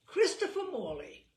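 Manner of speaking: a pleased fan's mock triumph; triumphant fan. Fan triumphantly naming him wrongly.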